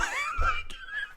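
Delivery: high-pitched burst of laughter; helpless high laugh; squealing laugh. Real, not acted. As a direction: crack up in a shrieking laugh. Laughing at own reenactment.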